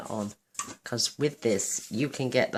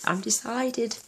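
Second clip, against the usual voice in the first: high-pitched repeat; 10 semitones higher, slower than usual. Real, not acted. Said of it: "repeated higher".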